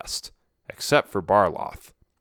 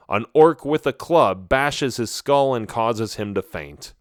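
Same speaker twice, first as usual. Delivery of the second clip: plain reading voice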